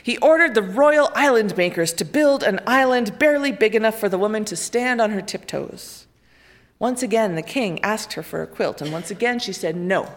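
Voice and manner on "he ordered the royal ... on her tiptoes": ordinary delivery, nothing marked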